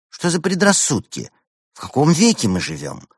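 high-pitched exasperated questions; indignant protest. Acted. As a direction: protest as if it's absurd. Friend indignantly protests a refusal.

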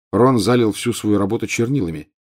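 usual speaking voice

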